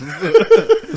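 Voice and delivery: high, loud burst of laughter. Real, not acted. Hearing a friend's dark remark about nets, startled loud laugh.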